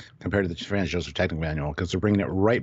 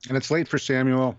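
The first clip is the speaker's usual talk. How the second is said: talking while chewing food; chewing noisily; 5 semitones higher than usual. Real, not acted. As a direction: say it through a mouthful. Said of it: "I'm sorry I'm eating".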